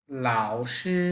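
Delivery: লাও dipping low, শি held level; লাও broken low, শি drawn out flat; Mandarin tones. Shown as a demonstration. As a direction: break লাও, then stretch শি. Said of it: "প্রথম পার্টটা একটু ভেঙে বলতে হবে, পরের পার্টটা টেনে".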